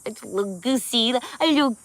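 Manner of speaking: goofy playful singsong; silly voice. Acted. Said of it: "when I get silly".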